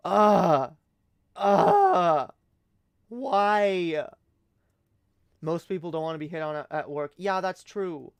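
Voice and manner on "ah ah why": loud, higher cry of despair; anguished wail. Acted. Reliving dad's wingman attempt, loud anguished cry. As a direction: wail it out in mock agony. Mortified groan at dad.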